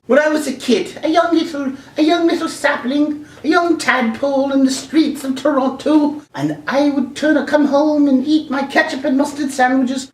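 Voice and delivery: high, mock-wistful storyteller voice; hammed-up high reminiscing; put-on nostalgic storyteller. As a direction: reminisce in a silly high-pitched voice. Nostalgic childhood speech in put-on voice.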